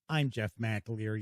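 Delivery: usual speaking voice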